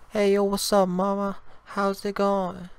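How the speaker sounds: normal talking voice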